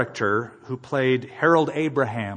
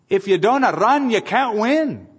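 raised high and loud, driving the line home; preached exhortation; 7 semitones higher, 4 dB louder than usual. Real, not acted. Pastor urging the congregation never to quit, loud and high. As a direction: proclaim it to the congregation. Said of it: "It's true. You just can't quit".

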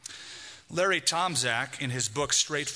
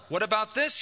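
demanding; 6 semitones higher than usual. A narrator's reading. Lynched man confronting God on the plain, demanding. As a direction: throw the question up as a challenge. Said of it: "he demanded".

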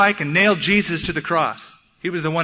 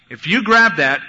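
parental warning; 3 semitones higher, 7 dB louder than usual. Retold or imitated. Father warning his toddler son.

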